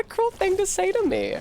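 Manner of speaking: hurt, melodramatic squeak; mock wounded squeak. Mock offended high voice.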